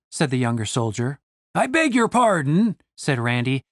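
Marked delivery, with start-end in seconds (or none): 1.5-2.8 higher, mildly offended; a touch of indignation; a little indignant. A narrator's reading. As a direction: bristle politely. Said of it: "just a little indignantly".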